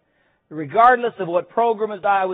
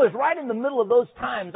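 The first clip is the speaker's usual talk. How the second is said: usual speaking voice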